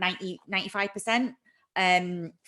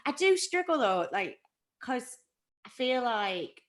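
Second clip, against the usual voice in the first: ordinary talk, nothing marked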